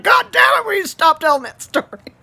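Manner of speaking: loud, high angry shout; pissed-off dad. Retold or imitated. Dad annoyed his son keeps telling the story, loud angry shout. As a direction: blow up at the son. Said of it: "he gets so pissed".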